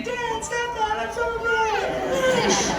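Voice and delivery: mock whiny kid. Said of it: "the kids were saying".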